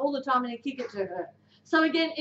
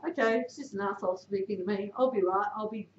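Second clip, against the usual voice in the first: lower, softer self-reassurance; calming self-talk; 6 semitones lower, 6 dB quieter than usual. Retold or imitated. Player calms self while being screamed at, low and soft. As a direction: talk yourself down quietly. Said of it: "makes them feel better knowing that".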